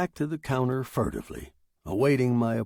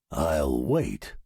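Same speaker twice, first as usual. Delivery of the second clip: low-pitched, cool reply; unruffled machine; 9 semitones lower than usual. Acted. Typewriter answering the writer's threat, low and cool.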